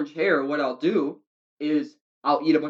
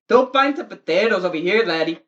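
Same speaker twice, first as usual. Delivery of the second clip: louder, higher hammed-up Irish pub order; mock Irish accent; 4 semitones higher, 8 dB louder than usual. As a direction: shout across the pub in a put-on Irish brogue. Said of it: "mock Irish pub talk".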